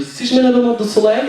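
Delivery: a hint of a Tunisian mum accent; mother-in-law's accent. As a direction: imitate her broken French lightly. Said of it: "Elle m'a dit".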